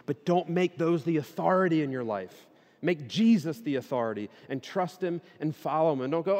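ordinary talk

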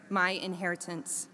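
ordinary talk, nothing marked

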